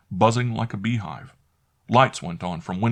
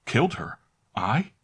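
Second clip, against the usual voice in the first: stunned disbelief; 5 semitones higher than usual. A narrator's reading. Stunned reply.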